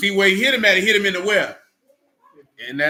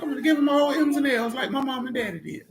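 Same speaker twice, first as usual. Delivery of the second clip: mimicking a student; 9 semitones higher than usual. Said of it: "She look like".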